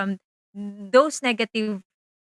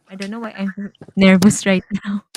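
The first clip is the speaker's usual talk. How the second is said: nervous laugh; 6 dB louder than usual. Real, not acted. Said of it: "I'm feeling nervous".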